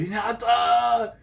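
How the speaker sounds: high, long-drawn name call; hammed-up excited shout-out; acted calling out. Acts out character calling Hinata.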